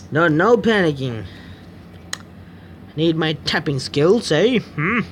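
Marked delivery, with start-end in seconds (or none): none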